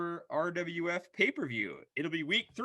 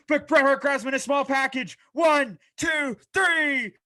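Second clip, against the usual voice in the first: higher, louder, shouting the three count; shouted pin count; 6 semitones higher, 9 dB louder than usual. Acted. Announcer calling the winning small package, shouting. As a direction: scream the finish like the crowd's on its feet.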